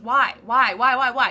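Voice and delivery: loud, repeated, pressing whys; insistent why. Shown as a demonstration. Insistent self-questioning.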